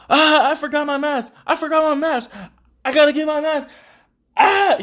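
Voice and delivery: high-pitched panicked wail, imitating her; mock freak-out. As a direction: mimic someone freaking out, voice shot way up. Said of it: "She started freaking out".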